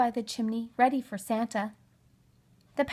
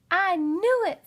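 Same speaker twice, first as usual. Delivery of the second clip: high, louder triumphant cry; 9 semitones higher, 6 dB louder, slower than usual. Acted. Amanda crowing to Oliver about Santa, high and triumphant. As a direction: gloat with delight.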